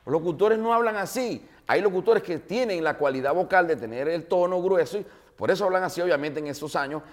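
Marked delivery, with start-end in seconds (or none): 0.0-1.5 high, loud firm denial; teacher shutting it down; hammed-up professor scolding; mock emphatic correction.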